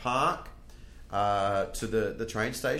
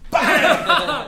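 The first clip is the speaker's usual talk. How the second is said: very high, very loud "bang"; shouted punch effect; 15 dB louder than usual. Retold or imitated. Storyteller acting out punching Woody in the head, explosive shout. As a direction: belt it out as a sudden blow.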